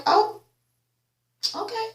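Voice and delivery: high, trailing okay; high fading oh okay; mock surprise. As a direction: back off with a startled okay. Reacting to threat.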